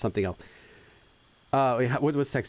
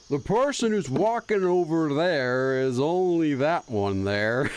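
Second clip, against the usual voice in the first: very slow, stretched Texas drawl; bad fake Texas accent; slower than usual. Streamer trying out a fake Texas accent, drawn-out. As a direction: stretch out a fake Texan twang. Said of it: "a bad impersonation of a Texas person".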